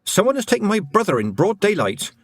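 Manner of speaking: put-on high young girl's surprise; a sharp, startled girl; Tiffany voice. A narrator's reading.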